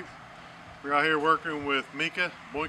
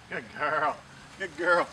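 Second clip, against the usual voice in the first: usual speaking voice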